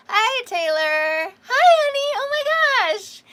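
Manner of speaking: high, loud gushing hello to a viewer; excited greeting. Real, not acted. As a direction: squeal a delighted welcome.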